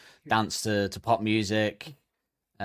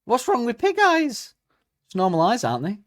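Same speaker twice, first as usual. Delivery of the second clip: indignant disbelief; 12 semitones higher, 5 dB louder than usual. Real, not acted. Indignant outburst at insult.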